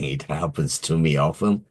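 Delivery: usual speaking voice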